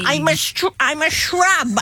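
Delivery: put-on shrub talking in a New Jersey accent; playful New Jersey accent, put on. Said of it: "read in a New Jersey accent".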